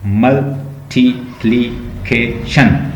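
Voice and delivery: Multiplication slowly split, each syllable on its own. Shown as a demonstration. Teacher splitting a long word to count, syllable by syllable. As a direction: break multiplication into separate, measured beats. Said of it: "देखें ये कितने".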